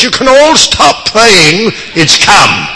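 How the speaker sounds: excited announcement. Retold or imitated. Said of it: "threw open the door and said".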